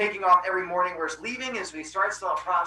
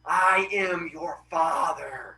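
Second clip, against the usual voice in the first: slow, drawn-out solemn reveal; film villain reference; slower than usual. Acted. Helios reveals himself to his son, hammed-up and slow. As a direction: stretch each word like a momentous revelation. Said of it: "thank you for getting my reference".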